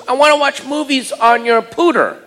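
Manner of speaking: put-on high little-kid voice; high child voice wanting movies; daughter's voice. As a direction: play the small daughter asking.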